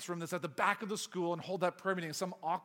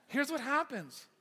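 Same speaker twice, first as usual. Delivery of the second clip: usual speaking voice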